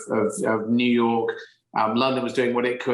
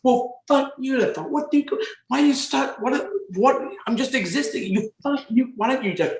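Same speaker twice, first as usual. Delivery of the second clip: much higher, frantic mock rant; retold shouting back; 12 semitones higher than usual. Shouting abuse back at a street heckler, high frantic rant. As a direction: rattle off an outraged squeaky comeback. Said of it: "And I go".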